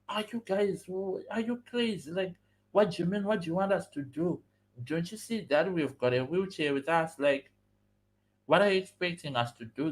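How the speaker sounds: high-pitched, exasperated protest; angry, agitated friend. Retold or imitated. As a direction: act out an agitated friend confronting the conductor. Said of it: "my friend Serge now was getting angry, very agitated".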